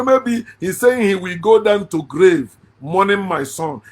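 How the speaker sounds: ordinary talk, nothing marked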